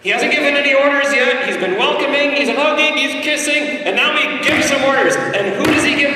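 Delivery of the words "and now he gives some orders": normal talking voice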